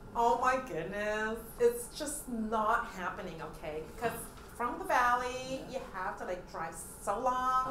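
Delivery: put-on slow, drawn-out Valley Girl accent; hammed-up Valley Girl complaint, stretching the words. Shown as a demonstration. Guest complains about a long drive, slow Valley Girl accent. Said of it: "Mga Valley Girl".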